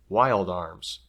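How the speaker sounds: ordinary delivery, nothing marked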